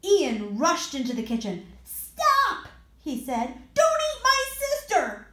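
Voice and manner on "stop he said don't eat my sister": loud urgent cry, much higher; shouting. Acted. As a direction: shout to stop them. Ian bursts in on monsters.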